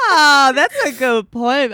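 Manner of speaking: loud, high, drawn-out delight; slow, gushing exclamation; delighted realisation. Real, not acted. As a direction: burst out, thrilled by the clever point. Delighted at host's clever observation.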